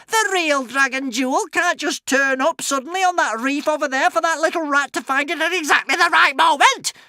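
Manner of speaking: high, loud witch voice; furious witch. A narrator's reading. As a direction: rage like a cheated witch. Witch raging at the news.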